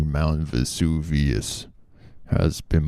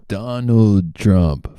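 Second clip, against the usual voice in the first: Trump impression; 5 semitones higher, 5 dB louder, much slower than usual. Shown as a demonstration. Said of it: "I'm terrible at it".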